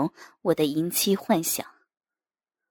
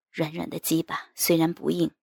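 plain reading voice

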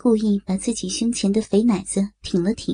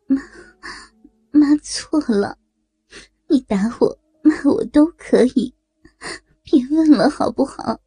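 tearful pleading; 2 semitones higher than usual. A narrator's reading. Mother-in-law begs son-in-law to stop asking, crying. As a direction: beg through sobs, rising toward the end. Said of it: "沈春荣又哭了起来".

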